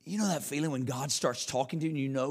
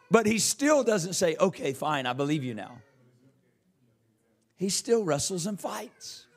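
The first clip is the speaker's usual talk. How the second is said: raised, higher-pitched sermon storytelling; preaching; 7 semitones higher, 5 dB louder than usual. Acted. Preaches at full force.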